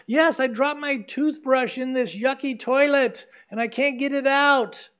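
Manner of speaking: put-on high, childlike voice; little boy. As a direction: play the innocent kid explaining. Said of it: "She goes".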